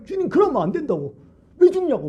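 high-pitched, heated objection; acted-out pleading protest, pitch shooting up; forceful protest. Peter stopping Jesus from going to die, forceful protest. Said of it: "막 그냥 막 강력하게 외쳤다".

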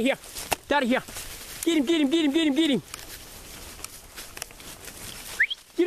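high sing-song dog calls; yelling from the sidelines. Real, not acted. Urging the dogs on the rabbit.

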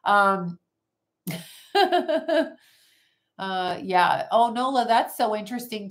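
usual speaking voice

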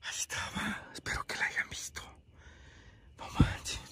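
susurro sigiloso y sin voz; susurra, todavía impactado. Real, not acted. Susurra tras el encuentro. (susurrando con asombro)

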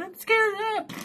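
very high, squeaky imitation of fear; hammed-up scared squeal; mock scared. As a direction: mimic a frightened friend in a tiny high voice. Mimics friend's frightened last words.

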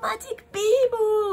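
high, slow, loud Japanese-English spell cry; kid casting spell. Acted. Boy jumps up and casts again, high slow spell cry. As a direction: shout it like a kid playing wizard.

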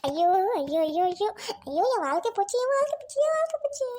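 hammed-up wailing of a wronged wife; theatrical lament. Wife laments her ruined life. (ஒப்பாரி வைத்துப் புலம்புவது)

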